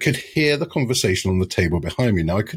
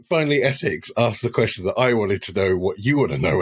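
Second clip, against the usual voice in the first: usual speaking voice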